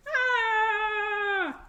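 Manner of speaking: high tantrum yell; kid yelling as loud as he can. Acted. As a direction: let out a big high kid's yell. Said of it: "yelled as loud as he could".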